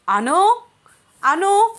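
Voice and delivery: shouted high call across distance; loud call. A narrator's reading. Abhiram searching for Anu, calling out loud and high. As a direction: yell her name to find her. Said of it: "అని గట్టిగా పిలుస్తున్నాడు".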